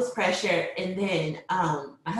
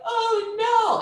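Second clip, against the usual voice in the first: high, drawn-out retold dismay; acted alarm; 4 dB louder than usual. Mother hearing heart rate is dropping, high acted alarm. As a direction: wail it high as re-enacted panic.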